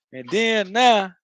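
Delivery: high, stretched Florida "then now"; Florida accent. Shown as a demonstration. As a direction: draw it out slow in Florida speech. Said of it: "that's how we say it in Florida".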